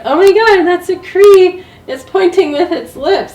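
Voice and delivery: high, loud and amazed, breaking into a laugh; excited retelling of a marvel. Retold or imitated. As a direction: replay the thrill of realizing it. Retold moment of amazed realization.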